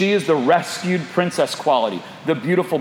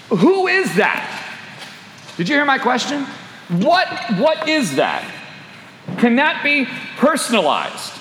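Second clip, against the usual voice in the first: pressing preacher; 8 semitones higher than usual. Acted. Preacher pressing his listeners with questions, insistent. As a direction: drive each question home to them.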